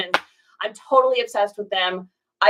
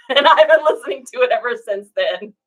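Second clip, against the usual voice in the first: words breaking into laughter; laughing; 3 semitones higher, 7 dB louder than usual. Real, not acted. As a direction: talk through a real laugh, louder. Laughs while talking.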